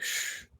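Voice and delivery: soft breathy shh; shush. Acted. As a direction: quietly hush him. NPC shushing again.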